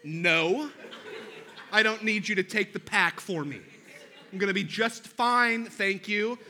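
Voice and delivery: husband refusing to give his wife the pack; wounded pride. Retold or imitated. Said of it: "the worst thing a wife could ever say".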